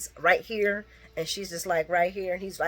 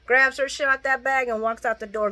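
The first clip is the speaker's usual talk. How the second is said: ordinary talk, nothing marked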